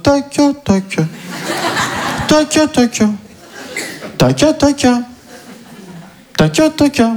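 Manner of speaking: taca taca chanted slowly, muttering in the street; lightly voiced mock creep. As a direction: play the weirdo muttering at night. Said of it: "en mode". (taca taca psalmodié, en mode bizarre dans la rue)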